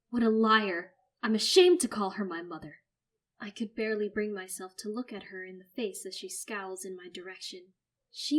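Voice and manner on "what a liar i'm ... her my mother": loud fury. Acted. Narrator's fury.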